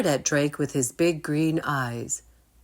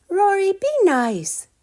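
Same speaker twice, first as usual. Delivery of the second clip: very high, loud scold; scolding girl; 7 dB louder than usual. Acted. Anna scolds Rory, very high and loud. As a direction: tell him off sharply. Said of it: "said Anna".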